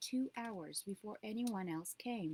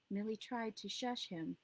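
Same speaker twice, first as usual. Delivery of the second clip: plain reading voice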